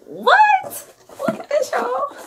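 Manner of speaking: very high, loud excited shriek; screamed what. Real, not acted. Vlogger showing viewers the full collection, shrieking.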